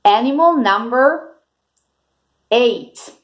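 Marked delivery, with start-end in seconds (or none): none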